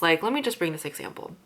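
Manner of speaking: usual speaking voice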